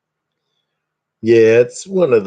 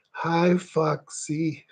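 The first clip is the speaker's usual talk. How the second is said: higher, very slow, drawn-out greeting; his way of saying it; 7 semitones higher, 7 dB quieter, much slower than usual. Shown as a demonstration. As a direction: stretch out a high, slow hi like him. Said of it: "I gotta say it like he's".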